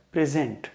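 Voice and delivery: Present with stress on its second part, pri-ZENT; pri-ZENT, stressed at the end; stress on second syllable. Shown as a demonstration. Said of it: "second part पे पड़ रहा है".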